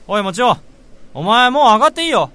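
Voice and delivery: loud, high, fast call across the office. Acted. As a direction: shout it over to him so he hears. Calling to coworker across office.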